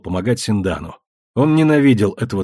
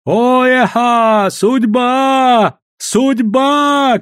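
high, loud wails; crowd shouting; 12 semitones higher, 4 dB louder, much slower than usual. A narrator's reading. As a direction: cry it out like an excited crowd. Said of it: "кричали кругом".

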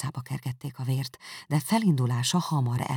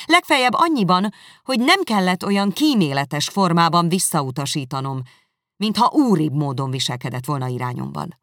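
loud, high, controlled fury; restrained anger; 3 semitones higher, 8 dB louder than usual. Acted. Elizabeth rejecting Darcy, loud with held-back anger. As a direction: hold the anger barely in check. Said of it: "egyre jobban elfutja a harag".

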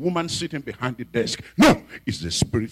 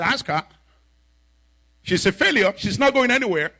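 taunting spirit; 8 semitones higher, 4 dB louder than usual. Retold or imitated. Spirit mocking the girl.

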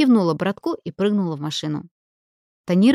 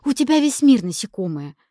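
higher-pitched, mildly exasperated comeback; snorting retort; 6 semitones higher, 4 dB louder than usual. A narrator's reading. Lina retorting to Olenka, mildly snorting. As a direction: toss it back with a touch of scorn. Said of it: "фыркнула Лина".

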